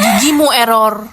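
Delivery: loud angry snap; mother snapping at Ren's excuse; angry retort. Acted. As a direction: shout it back at her. Mother snaps back.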